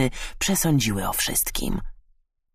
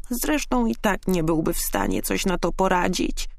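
higher-pitched, dismissive finch voice; resigned; 6 semitones higher than usual. A narrator's reading. Finch writing off her husband, resigned voice. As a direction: give up on him, mildly resigned. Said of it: "machnęła z rezygnacją".

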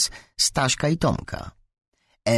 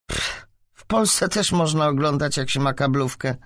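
put-on scornful higher-pitched girl, opening on a snort; contemptuous snort; 7 semitones higher than usual. A narrator's reading. Kaśka sneers at the new girl's story, contemptuous snort. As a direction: scoff dismissively. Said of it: "prychnęła pogardliwie Kaśka".

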